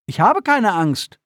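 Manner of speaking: put-on high, slow, insistent boy's denial; energetic protest. A narrator's reading. Boy insists to his father he is not scared, energetic. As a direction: push back hard like a proud kid. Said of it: "stieß Alaska energisch hervor".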